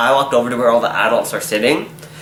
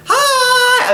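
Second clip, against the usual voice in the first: much higher, loud squeaky hi; excited kid; 4 dB louder than usual. Retold or imitated. Kid greeting her in the Wendy's lineup, high and loud. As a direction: squeal like an eager little kid. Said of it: "he was like".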